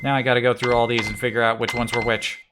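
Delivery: normal talking voice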